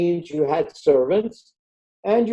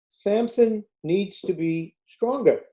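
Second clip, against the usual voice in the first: ordinary talk, nothing marked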